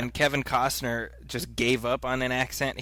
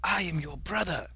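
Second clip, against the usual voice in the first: higher, hushed dramatic declaration; movie character's dramatic reveal; 4 semitones higher, 6 dB quieter than usual. Acted. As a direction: reveal it softly, high and grandly. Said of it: "he, he's like".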